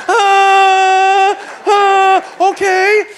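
loud, high whining groan; hammed-up groan. Husband asked to stop at the store, mock-whining. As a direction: groan in exaggerated protest, then give in. Husband's reluctant reaction to wife's text.

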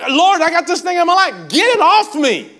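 high, loud preacher's cry; acted desperate plea. Apostle begging the Lord to remove the thorn, acted desperate plea. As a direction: cry out to heaven, dragging the last words. Acts Paul's desperate prayer.

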